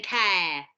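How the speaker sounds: "care" for cow, vowel drawn out; long London vowel. Shown as a demonstration. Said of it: "Your vowels get really long".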